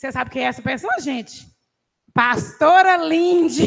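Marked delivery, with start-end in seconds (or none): 2.1-3.7 louder, slow, name drawn out through laughter; real laughing reveal of the pastor. Real, not acted.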